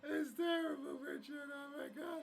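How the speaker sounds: high, loud hammed-up wailing; overacted sobbing grief; mock wailing grief. Mock grief over dead character.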